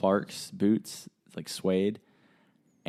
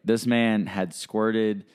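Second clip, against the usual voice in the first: usual speaking voice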